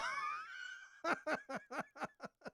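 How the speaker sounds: shrill laugh and shout; despairing laugh. Real, not acted. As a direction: laugh out the frustration.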